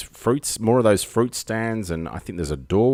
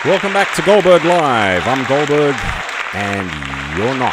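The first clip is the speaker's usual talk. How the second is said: announcer voice; 4 semitones higher, 7 dB louder than usual. Acted. Show host opening greeting.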